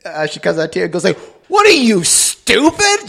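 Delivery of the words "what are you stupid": high-pitched, louder indignant jab; mock yelling. Friend acts out yelling at a heckler, high mock outrage. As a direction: imitate someone yelling an insult. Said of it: "If she ever yells at you again".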